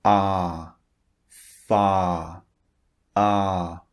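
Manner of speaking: Ah and far with a long held vowel. Shown as a demonstration. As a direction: stretch the Ah in far. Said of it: "the first vowel is long".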